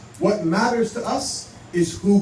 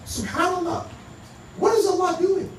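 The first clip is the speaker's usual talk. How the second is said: much higher-pitched awed wondering; amazed wonder; 11 semitones higher than usual. Retold or imitated. Speaker recalls thinking to himself at the shahada, amazed wonder. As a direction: retell an astonished thought, pitched right up. Retells own amazed thought.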